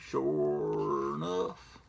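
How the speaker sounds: slow, stretched-out country drawl; drawled folksy voice. Pretend. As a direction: play a lazy folksy drawl. After "pretty darn sure.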